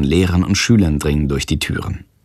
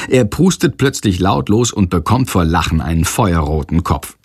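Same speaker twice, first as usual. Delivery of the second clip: ordinary delivery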